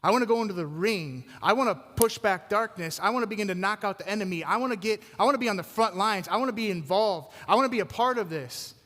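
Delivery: voicing Paul's resolve, high and loud; insistent, punchy preacher's rally cry; fired-up declaration. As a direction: build it like a fighter rallying for the ring. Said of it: "but Paul's saying".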